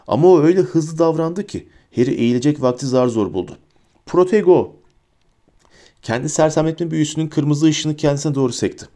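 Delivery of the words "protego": high, urgent spell cry; called-out spell. A narrator's reading. Bellatrix blocks Harry's spell, calling out. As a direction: fire off the spell in a raised voice. Witch calls out defensive spell.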